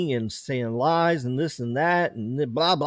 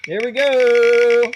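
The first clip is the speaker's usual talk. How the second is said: high, loud, slow reveal; hyped reveal; 9 semitones higher, 7 dB louder, much slower than usual. Acted. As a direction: stretch it like a game show host. Wheel landing.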